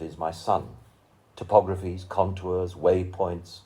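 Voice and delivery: measured, rhythmic poem recital with lingering pauses; poetry reading. Acted. Reading a friend's poem aloud to an audience, solemn recital. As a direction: let each image land, unhurried and solemn.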